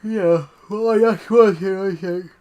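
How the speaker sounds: talking through a yawn; words stretched by a yawn. Real, not acted. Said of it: "Sorry, I'm yawning".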